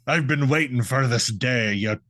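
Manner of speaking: hammed-up Scottish brogue sliding into pirate; Scottish, turning pirate. Scottish ghost defies his rival, mock Scottish pirate accent. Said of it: "the more Scottish one goes".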